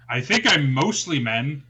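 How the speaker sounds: ordinary talk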